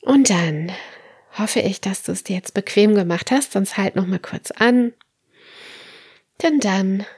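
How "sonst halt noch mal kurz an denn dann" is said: ordinary delivery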